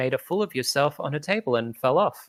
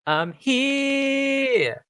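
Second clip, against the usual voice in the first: high ringing proclamation, much higher; belted out; 13 semitones higher than usual. Acted. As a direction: belt it out to fill the arena. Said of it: "you just belt out".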